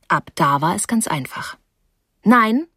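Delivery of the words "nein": firm, louder refusal. A narrator's reading. She refuses to stay with Terrapin, very firm. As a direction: refuse with full resolve, a little forceful. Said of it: "sagte ich mit sehr fester Stimme".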